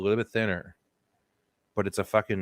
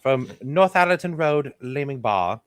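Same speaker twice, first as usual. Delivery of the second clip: put-on Leaming Bar accent; 4 semitones higher than usual. Telling friend about the horse lady, in a put-on Leaming Bar accent. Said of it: "that is a good Leaming Bar accent".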